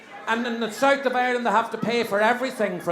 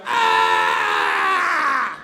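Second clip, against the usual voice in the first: mock howl of pain; 4 semitones higher than usual. Injured man heard from casualty, mock howl. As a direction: imitate an awful racket of pain. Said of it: "there was this awful racket".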